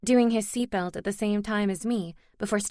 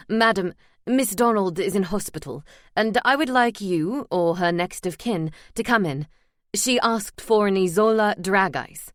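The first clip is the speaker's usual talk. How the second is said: formal caller; 5 dB louder than usual. Acted. Hospital man on phone.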